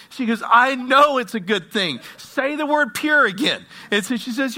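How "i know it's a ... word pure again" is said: high, loud retold outburst; impassioned cry, without real sobbing; overwrought plea. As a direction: act out an overwhelmed woman pleading. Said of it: "she starts breaking down, bawling, crying".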